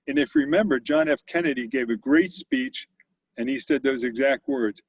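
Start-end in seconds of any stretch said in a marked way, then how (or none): none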